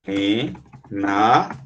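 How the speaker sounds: renaço drawn out very slowly and low, re-nas-ço; slow syllable split. Shown as a demonstration. Teacher separating renasço for the class, slow and low. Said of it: "Como é que separa renasço?".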